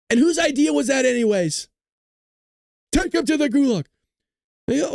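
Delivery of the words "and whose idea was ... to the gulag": mock angry boss. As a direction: bark out the blame in a hammed-up rage. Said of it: "then they're like".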